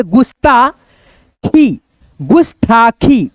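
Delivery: गुस्ताखी very slow and drawn out; slow demo. Shown as a demonstration. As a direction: drag गुस्ताखी out for the class. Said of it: "मिला करके पढ़ेंगे". (गुस्ताखी धीरे खींचकर)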